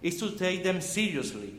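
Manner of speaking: ordinary talk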